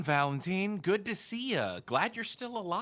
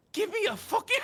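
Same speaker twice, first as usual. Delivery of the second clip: put-on higher, quick Irish accent try collapsing into a laugh; accent attempt breaking into laughter; faster than usual. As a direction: start the Irish line and crack up. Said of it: "I can't say fucking in that accent".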